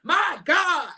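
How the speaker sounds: high laughing my God. Real, not acted. Laughing as she tells viewers about gospel rap. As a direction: laugh through the words, delighted.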